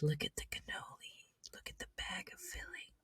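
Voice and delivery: soft unvoiced whisper; ASMR whisper. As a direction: breathe it out like ASMR. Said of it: "A S M R voice".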